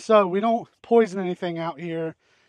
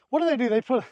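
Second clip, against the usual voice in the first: out of breath, panting between words; 5 semitones higher, faster than usual. Real, not acted. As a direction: talk while winded from work. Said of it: "I'm out of breath".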